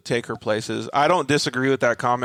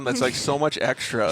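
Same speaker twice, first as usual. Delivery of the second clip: ordinary delivery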